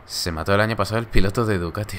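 usual speaking voice

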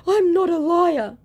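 high, loud wailed protest; hammed-up protest, pitched right up; mock outraged denial. Busted scammer insisting to the woman, mock outrage.

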